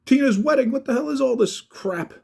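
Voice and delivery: high, loud exasperated yell, building; angry outburst. Retold or imitated. Friend scolds fighting brothers at wedding, exasperated yelling. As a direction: let the anger boil over. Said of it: "finally, I get pissed".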